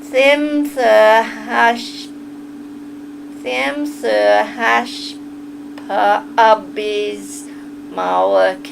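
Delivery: very slow, drawn-out Southern drawl; stretched Southern vowels with gaps between phrases; Southern accent. Shown as a demonstration. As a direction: practise a Southern drawl word by word. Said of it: "I'm trying to learn a southern accent".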